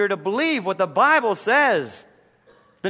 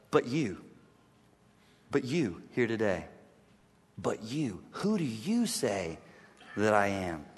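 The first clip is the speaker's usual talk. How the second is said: low, soft, searching question; hushed solemn question; 8 semitones lower, 8 dB quieter than usual. Acted. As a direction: ask it quietly, eye to eye. Hushed repetition of Jesus' question.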